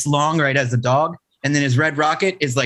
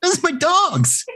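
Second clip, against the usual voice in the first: mock high voice. Repeats in high voice.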